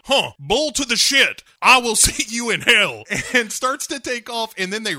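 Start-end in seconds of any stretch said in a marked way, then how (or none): 0.0-3.0 loud, high hammed-up defiance; mock tough-guy yell with a laugh; mock defiant shout. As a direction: bark back a cartoon refusal. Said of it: "he immediately is like".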